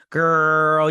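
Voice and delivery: loud, drawn-out sassy call; imitating a hype-up friend; emphatic girlfriend pep talk.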